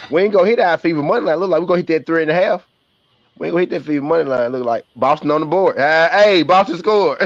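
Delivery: cheering the bets as the game swings; excited. Real, not acted. Betting excitement while watching game.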